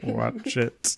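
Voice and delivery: villain voice, smug and menacing; a light try at the Sir Crocodile villain voice. Retold or imitated. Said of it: "You hear it in Sir Crocodile's voice".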